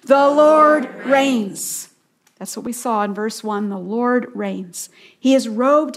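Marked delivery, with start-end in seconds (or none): 0.0-1.9 very slow, drawn out and firm; a very slow, drawn-out refrain led for the listeners; slow proclaimed refrain. Real, not acted. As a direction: stretch it for everyone to join. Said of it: "say it with me".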